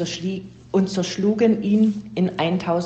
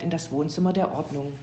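plain reading voice

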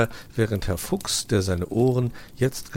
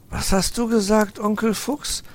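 put-on high, louder questioning boy; curious young fox; 14 semitones higher, 5 dB louder than usual. Nephew questions uncle, high boy voice. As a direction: catch him out innocently. Weißohr asks.

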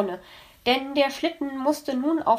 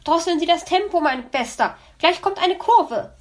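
higher, louder warning, slowing at the end; calling out; 8 semitones higher, 6 dB louder than usual. Acted. Magpie calling a warning to the driving fox, louder and higher. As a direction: warn him of the bend ahead. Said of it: "rief die Elster".

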